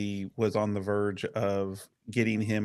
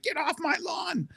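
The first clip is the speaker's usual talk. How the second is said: high mock cranky yell; the governor with a broom at a camper, mocked as a cranky old man; grumpy old man. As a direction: shoo them off like a grumpy old neighbour. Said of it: "and he's, like".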